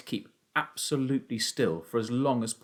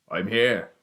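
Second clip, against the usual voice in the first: raised adult call; 5 dB louder than usual. A narrator's reading. Hidden father answers his searching son, calling out. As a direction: call back to someone searching. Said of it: "the voice called out".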